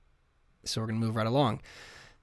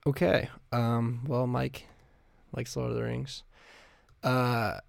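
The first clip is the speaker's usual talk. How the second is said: ordinary talk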